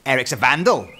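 loud tattling little sister; stirring. A narrator's reading. Little sister tells Dad on Eric, stirring. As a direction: tell on him to stir trouble.

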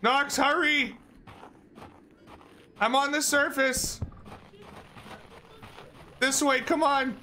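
high urgent shouts; being chased. Real, not acted. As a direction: yell for a teammate while fleeing. Fleeing, calling teammate.

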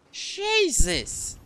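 sudden high yell of fright; startled shout. Real, not acted. Streamer jumps at something in the game, yelling in shock. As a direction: jolt out the word in shock.

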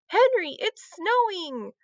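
high, excited call. A narrator's reading. Heidi to Henry on the way downstairs, excited call. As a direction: call the news to someone in another room. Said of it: "Heidi called".

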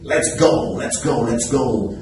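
upbeat call to get going; rally the class to start; cheerful pep call; excited rally. Real, not acted. Teacher rallying students.

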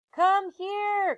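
high call across the woods. Acted. Annie calling Jack over to the oak tree, high call. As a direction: sing out for him to come. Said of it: "called Annie".

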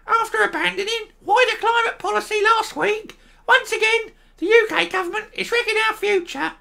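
very high, small squeaky voice mocking a minister; tiny squeaky voice. Host mocking a small politician's quote in a high squeak. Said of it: "He doesn't actually speak like that, of course, but he is very small".

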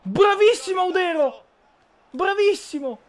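high, loud cheering praise; excited cheer. Real, not acted. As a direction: cheer the keeper on. Cheering a save.

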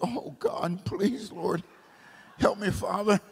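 hushed mock-desperate prayer; very soft retold appeal; private plea to God. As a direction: plead softly, as if under your breath.

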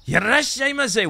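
high, loud shop call; shouted greeting. Acted. Shopkeeper hailing a customer who walks in, high yell. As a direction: holler it out like a Japanese store greeter.

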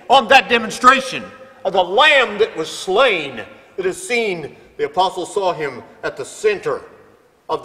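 loud, high, impassioned preaching; preaching at full force. Acted. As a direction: build it up to a fervent peak. Preacher climaxing about heaven.

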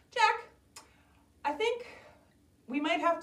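high-pitched storybook mother; Jack's mom. Acted. Mom breaking news to Jack, high-pitched mother voice. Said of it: "Jack's mom says".

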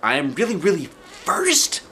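put-on amazed child. A narrator's reading.

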